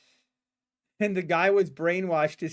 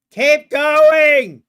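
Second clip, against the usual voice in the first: sudden, much louder, higher shout; yelling; 8 semitones higher, 15 dB louder than usual. Real, not acted. Streamer yells at the market chart, a full real shout. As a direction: bark it at the screen. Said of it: "I'm sorry for yelling".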